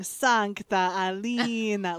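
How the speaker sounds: put-on slow, drawn-out Russian accent; mock Russian accent, dragged out; bad Russian accent. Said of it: "a voice actor in a horrible Russian accent".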